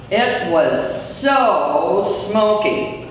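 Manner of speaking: ordinary delivery, nothing marked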